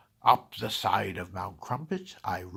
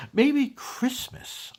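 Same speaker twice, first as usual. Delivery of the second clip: dawning wonder; 12 semitones higher, 7 dB louder, slower than usual. Acted. Grinch's realisation, high and slow.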